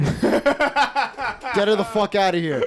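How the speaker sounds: high, drawn-out mock yell; hammed-up outraged bellow; mock furious shout. Doorman on a power trip demands she leave, mock furious shout. Repeats the ejection order, far higher.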